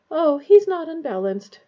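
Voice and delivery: ordinary delivery, nothing marked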